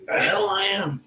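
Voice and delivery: high, indignant mock protest; defiant Peter. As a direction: fire back as a stubborn disciple. Peter's defiant denial paraphrased.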